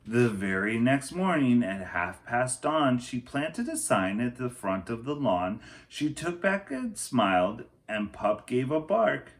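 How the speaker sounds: put-on lively read-aloud for children; picture book reading. A narrator's reading. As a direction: tell the story to a class.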